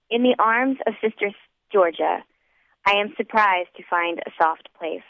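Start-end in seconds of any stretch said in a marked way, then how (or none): none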